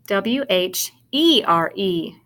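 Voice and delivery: slow "W H E R E", letter by letter; a beat for each letter of "W H E R E"; spelling aloud. Shown as a demonstration. Teacher starting over after a mistake, spelling aloud. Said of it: "Let's start over".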